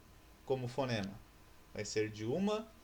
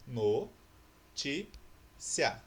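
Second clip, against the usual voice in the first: "No, ti, cia" in separate beats, pauses between; syllable by syllable; 3 dB louder, much slower than usual. Shown as a demonstration. Teacher splitting notícia for pupils, "No, ti, cia" syllable by syllable. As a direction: break "No, ti, cia" into isolated syllables. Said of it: "como que eu separo a palavra notícia?".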